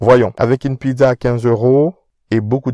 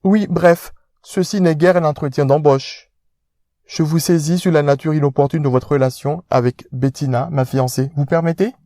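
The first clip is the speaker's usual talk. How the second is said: pompous rich fiancé, pitched up; posh fiancé; 5 semitones higher than usual. Acted. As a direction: speak down to him like an aristocrat.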